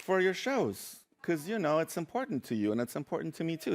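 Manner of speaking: soft, gentle explanation; tender. Retold or imitated. Mom giving a blazer for the shows, tender. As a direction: say it softly and sincerely.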